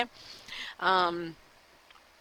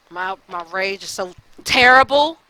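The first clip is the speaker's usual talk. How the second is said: a real burst of rage, much louder; frustrated outburst; 9 dB louder than usual. Real, not acted. Vlogger venting his temper to viewers, loud outburst. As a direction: let the anger spill out on the repeat. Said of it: "But I'm just so frustrated".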